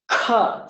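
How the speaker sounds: kha with a strong puff of breath; breathy aspirated kha. Shown as a demonstration. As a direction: breathe hard out on the k. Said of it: "you should feel the breath on your hand".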